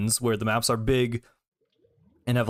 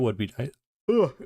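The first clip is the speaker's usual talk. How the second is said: yawning mid-sentence; words pulled out of shape by a yawn. Real, not acted. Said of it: "I started yawning mid-sentence".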